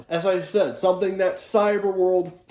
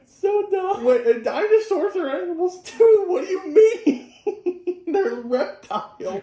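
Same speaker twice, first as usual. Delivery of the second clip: high squeaky pitch through a laughing fit; incredulous laughter. Real, not acted. Reviewer objecting that dinosaurs are animals, laughing incredulously. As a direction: protest helplessly while cracking up.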